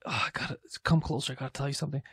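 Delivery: faint, breathy dying plea; very soft last words of a dying man; overacted death scene. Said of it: "And he's like".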